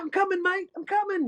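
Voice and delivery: high-pitched Aussie call back; retold calling back. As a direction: imitate a mate calling from afar. Retold friend calling back from cliff.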